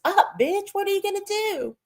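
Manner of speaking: high sing-song jeer; sneering high-pitched dig; mocking taunt. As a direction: needle her with a high mocking lilt. Taunting Liv about daycare time.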